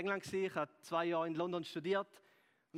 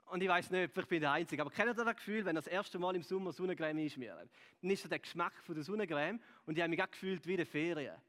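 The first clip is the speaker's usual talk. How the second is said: normal talking voice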